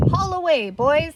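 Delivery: loud, commanding call to the men; sheriff's order. Acted. Sheriff calling his men to haul, loud command. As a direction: bark the order at the crowd.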